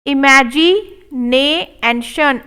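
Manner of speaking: imagination drawn out; slow model take. Shown as a demonstration. Teacher modelling imagination slowly. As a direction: stretch it syllable by syllable. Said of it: "slow model take".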